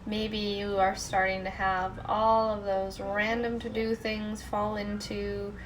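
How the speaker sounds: soft, unhurried meditation voice; guided meditation. Real, not acted. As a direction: gently guide listeners into letting go.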